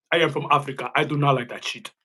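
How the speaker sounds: careful "I am from Africa" in a mock African accent, syllables spelled out. Mocking Walter's speech in a mock African accent. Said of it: "Trying to sound righteous out here".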